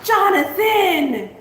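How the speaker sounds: long, high, loud call of the name; excited call. Real, not acted. Host spots a viewer's super chat, excited call. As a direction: call out his name with a thrill.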